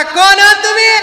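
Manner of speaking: high, loud, challenging call from inside; sharp woman's call. Acted. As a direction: demand who's there, high and loud. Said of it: "तिनं असा आवाज दिला". (घरातून चढ्या आवाजात विचारणारी बाई)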